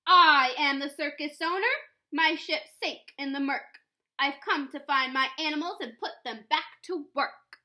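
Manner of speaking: booming, self-important announcement; loud and clear. Acted. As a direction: proclaim it to the whole town. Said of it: "whose voice was loud and clear".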